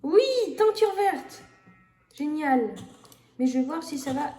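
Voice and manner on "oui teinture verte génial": excited cheer. Real, not acted. Finds the green dye recipe.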